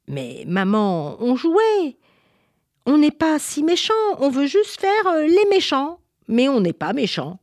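put-on high-pitched childish pleading; protesting child. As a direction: whine innocently to mum in self-defence. Children protest to their mother.

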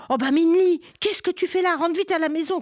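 startled father calling his daughter home; father shouting. Acted. Father crying out at Minli hiding, loud and high. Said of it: "cria son père".

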